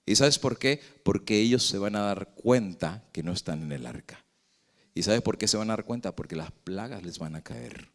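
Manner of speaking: normal talking voice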